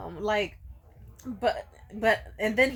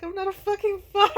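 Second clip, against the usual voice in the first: high, mock tearful protest; about to cry; 9 semitones higher, 5 dB louder than usual. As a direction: wail it like someone close to tears. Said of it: "about to cry and shit".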